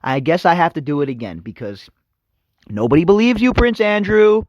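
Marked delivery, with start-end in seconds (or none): 2.7-4.4 high, emphatic declaration; mocking proclamation. Real, not acted. Host tells Prince Andrew nobody believes him, mocking proclamation. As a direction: proclaim it in a raised, ringing voice.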